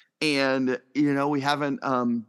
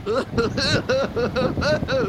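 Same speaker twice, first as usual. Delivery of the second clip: high squealing laughter on a ride; thrilled squealing; 10 semitones higher, slower than usual. Acted. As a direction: shriek and laugh as it drops.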